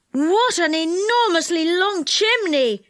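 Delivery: high, drawn-out call; calling back. A narrator's reading. Joe calls back down the long chimney, amazed. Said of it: "he called back".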